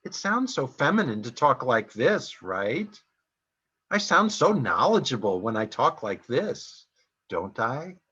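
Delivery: every phrase ends on a rising question tune; mocking uptalk. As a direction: parody the therapist's advice with lilting question endings. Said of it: "by ending all my sentences with a question".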